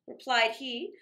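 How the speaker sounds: plain reading voice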